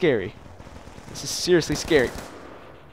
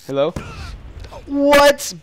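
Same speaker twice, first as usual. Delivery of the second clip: normal talking voice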